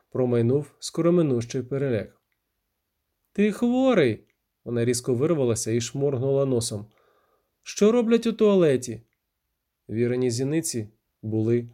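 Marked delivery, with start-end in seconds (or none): 3.3-4.2 high, sharp exclamation; indignant outburst. Acted. Віра breaking free of the narrator, indignant. 7.6-9.0 high, indignant question; exasperated retort. Acted. Віра retorting to his questioning, exasperated.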